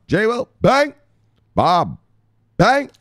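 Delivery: bangs shot up high and loud; bang shout-outs. Real, not acted. Streamer shouting out followers by name, loud bangs. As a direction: punch each bang out.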